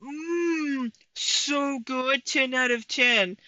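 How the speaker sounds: a hint of an eager taster savoring a bite; Amberlynn tasting food. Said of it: "Okay, Amberlynn liked that one".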